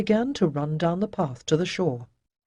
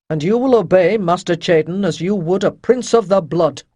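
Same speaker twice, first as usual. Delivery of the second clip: louder, firm decree; commanding captain; 7 dB louder than usual. Acted. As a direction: lay down the law to Avon. Rydell lays down the law.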